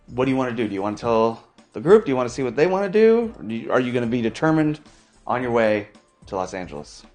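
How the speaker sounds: ordinary talk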